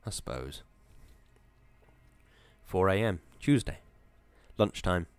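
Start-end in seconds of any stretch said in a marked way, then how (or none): 0.0-0.6 much lower and softer, defeated; a miserable, resigned echo. A narrator's reading. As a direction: let it sink, giving up. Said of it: "Wisconski said miserably".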